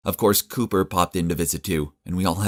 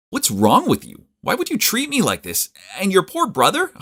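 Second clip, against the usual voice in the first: much higher, louder outraged questions; furious confrontation; 13 semitones higher, 4 dB louder than usual. A narrator's reading. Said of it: "I confronted her on the spot".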